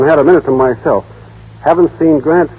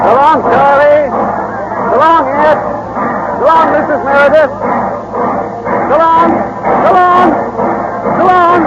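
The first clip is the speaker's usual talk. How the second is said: calling goodbye. Acted. Leaving on the train, calling goodbye to hometown friends. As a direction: shout so long over the noise.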